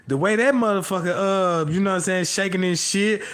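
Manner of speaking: usual speaking voice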